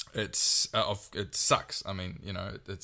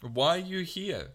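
high, incredulous mock questioning; mock Ukrainian civilian; 10 semitones higher than usual. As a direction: play the baffled local confronting soldiers, pitched way up. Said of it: "be like".

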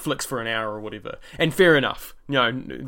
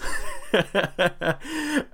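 bright burst of laughter, pitched up; real laugh; 7 semitones higher than usual. Real, not acted. Telling coworkers he hit number one, real laugh. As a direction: laugh at your own big news. Laughs after announcing top spot.

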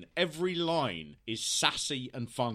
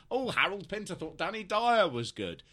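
higher-pitched, put-on surprise; mock astonishment; 6 semitones higher, 3 dB louder than usual. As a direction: act mildly scandalised, pitch lifted. Mimics snobbish surprise at culture clash.

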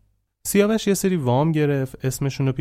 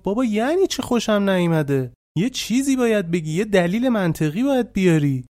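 higher-pitched protest to her father, a retold yell; retold shout at father; 7 semitones higher than usual. Daughter protesting to her father, higher mock yell. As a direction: push back at dad, voice up high. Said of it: "داد زد گفت".

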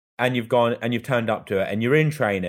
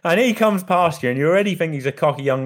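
talking through laughter, higher; laughing; 7 semitones higher, 4 dB louder than usual. Real, not acted. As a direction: laugh the words out, pitched up. Laughing while retelling.

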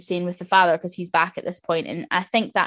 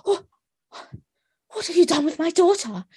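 high, panicked mother's cry; imitating a frantic mother demanding her child back; Nicole Kidman's horrified mother; 10 semitones higher than usual. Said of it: "and she goes".

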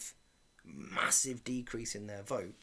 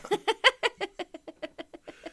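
high laughing fit; 8 dB louder than usual. Real, not acted. Laughing fit after big finish.

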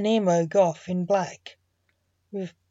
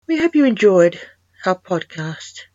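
louder, upbeat outro announcement; podcast sign-off; 6 dB louder than usual. Real, not acted. Host invites listeners back for more stories, podcast sign-off.

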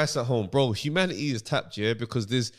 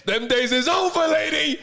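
mock defiant shout; 12 semitones higher, 4 dB louder, slower than usual. Acting defiant worker snapping back.

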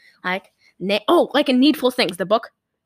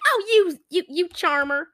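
high, fluttery waitress impression; flirty waitress; 6 semitones higher than usual. Waitress to the groping sheriff, mock-flirty. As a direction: put on a higher voice, mock-charmed.

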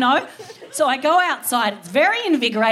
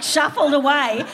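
normal talking voice

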